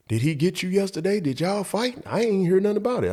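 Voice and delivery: a hint of the curious classmate; classmate's voice.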